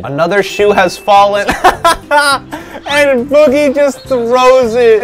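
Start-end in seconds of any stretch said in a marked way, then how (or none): 1.5-5.0 higher, slowed words through laughter; laughing. Real, not acted. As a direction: barely hold it together telling the play. Cracking up at thrown shoe.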